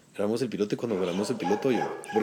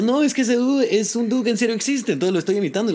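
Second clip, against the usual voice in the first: excited explanation; 11 semitones higher, 4 dB louder than usual. Retold or imitated.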